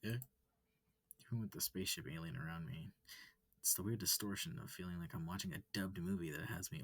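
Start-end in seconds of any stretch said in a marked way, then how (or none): none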